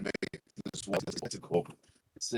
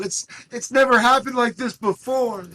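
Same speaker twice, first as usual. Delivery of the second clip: very high-pitched loud wail; shouting in character; 11 dB louder than usual. Acted. Player shouting his character's excuse, high loud wail. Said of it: "I shout".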